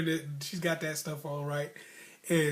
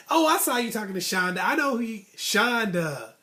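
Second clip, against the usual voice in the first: higher and louder, mock accusing; teasing girlfriend voice; 5 semitones higher, 7 dB louder than usual. Date teases him about talking to Shonda, higher and louder. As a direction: play the jealous date calling him out. Date's teasing jealous quote.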